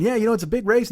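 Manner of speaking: high, eager mock chatter; enthusiastic chatter. Stranger keeps talking at two racers, enthusiastic. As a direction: imitate an over-keen race fan. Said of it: "He's all enthusiastic and inspired".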